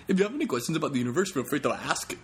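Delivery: sped-up stand-up impression; character impression. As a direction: rattle it off high in a quick character voice. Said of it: "I did actually do that impression".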